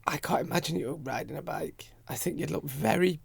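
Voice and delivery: unvoiced teasing whisper. Real, not acted. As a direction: lean in and whisper the jibe.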